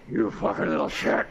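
slow, odd voiced insult; demonstrating the right fat man voice; fat man voice demo. Shown as a demonstration. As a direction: show how the fat man says it. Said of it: "say it like I said it".

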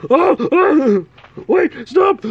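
high, loud frantic plea; panicked girl. Acted. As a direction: shriek for him to stop. Victim begging in panic.

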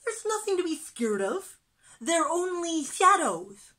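high, loud, perky insistence; reassuring little fish. A narrator's reading. Little fish calming scared friends, high and confident.

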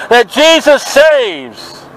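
normal talking voice

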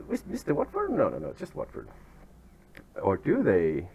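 normal talking voice

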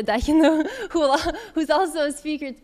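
real laugh breaking into the words; amused, laughing as you name a colleague. Real, not acted. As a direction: chuckle through the introduction. Laughs while naming a colleague.

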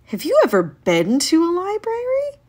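high, eager animal voice; squeaky, wide-eyed critter; porcupine voice. Acted. Porcupine asks Bunny, excited.

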